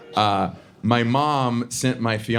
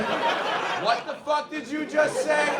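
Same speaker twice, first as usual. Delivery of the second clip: much higher, slow drawn-out mock outrage; imitating the attendants; 4 dB quieter, slower than usual. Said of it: "stopped what they were doing and go".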